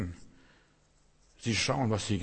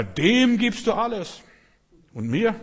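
higher, louder indignant complaint; jealous elder brother; 10 semitones higher, 7 dB louder, slower than usual. Acted. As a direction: protest it's unfair. (empört als eifersüchtiger älterer Bruder)

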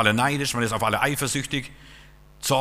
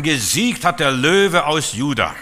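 triumphant proclamation; 4 semitones higher, 7 dB louder, slower than usual. Real, not acted.

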